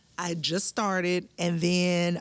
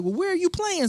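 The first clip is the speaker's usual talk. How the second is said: much higher, bright mock man voice; lightly voiced friendly man; 12 semitones higher, 4 dB louder than usual. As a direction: squeak it like a friendly stranger. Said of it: "the guy was like".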